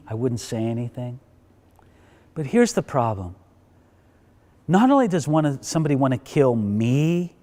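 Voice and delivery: lightly acted, soft-selling plea; understated pleading that sinks away; earnest appeal. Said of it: "she's kinda selling it there to him".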